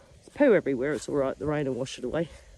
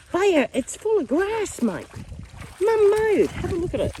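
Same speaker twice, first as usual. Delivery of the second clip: very high, loud singsong to a dog; pet-directed talk; 13 semitones higher, 6 dB louder than usual. Real, not acted. Owner calls her dog to the mowed grass, high singsong.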